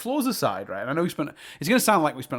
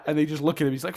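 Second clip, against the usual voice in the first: normal talking voice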